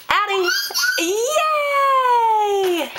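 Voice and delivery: high delighted laughing cheer. Real, not acted. Delighted mom greets baby.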